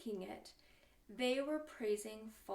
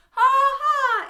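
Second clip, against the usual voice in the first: very high, shouted "ha ha"; big performed ha-ha for kids; loud sung-out laugh; 13 semitones higher, 23 dB louder than usual. Shown as a demonstration. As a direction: belt out a pretend laugh. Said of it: "laugh out loud".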